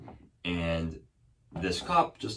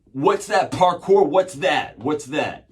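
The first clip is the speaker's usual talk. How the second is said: loud, high imitation of a demanding officer; aggressive cop's challenge; 8 semitones higher, 11 dB louder, slower than usual. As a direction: hammer out the questions as a mock cop. Cop challenges him in retold story.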